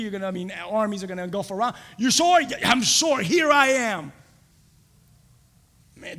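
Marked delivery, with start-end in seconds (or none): 2.0-4.1 loud, high, insistent exchange; emphatic answer. Acted. Believer confirming he will go, loud emphatic answer. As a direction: declare it firmly at raised volume. Acted challenge and answer to call.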